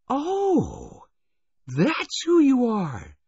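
very high, drawn-out startled cry; surprised exclamation. A narrator's reading. Daffodil recognising the waiter, high surprised exclaim. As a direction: gasp it out in sudden recognition. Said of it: "exclaimed Daffodil".

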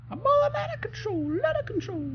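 very high, squeaky sing-song; silly falsetto. Real, not acted. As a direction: squeal it like a giddy cartoon. Goofing while running fast.

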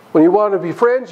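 ordinary delivery

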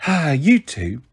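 exasperated sigh, only pretending crossness; sighing mock-cross. A narrator's reading. Mum pretending to be cross with both, sighing. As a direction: sigh it out as a fondly fed-up mum. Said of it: "Mum sighed".

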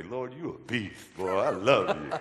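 grin up at the sky and laugh it out; laughing praise. Retold or imitated. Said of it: "I always look up and say".

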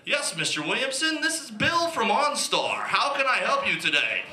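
high, polite operator voice; customer service voice. Acted. OnStar operator answering the drunk driver, polite high service voice. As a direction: chipper helpdesk operator. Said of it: "he said".

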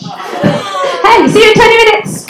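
usual speaking voice

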